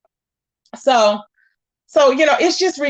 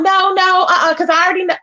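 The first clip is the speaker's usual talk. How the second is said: mildly raised, flustered protest; mock freak-out; 5 semitones higher than usual. Vlogger mimics woman refusing a simple question, mock freak-out. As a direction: imitate someone freaking out, a little higher. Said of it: "she's freaking out".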